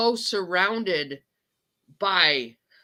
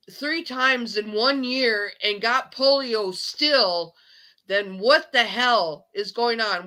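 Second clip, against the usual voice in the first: worked-up rant, voice pushed higher; real upset, pitched up in alarm; very emotional; 4 semitones higher than usual. Real, not acted. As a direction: hammer it out, emotional and emphatic. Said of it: "I just got very, very emotional".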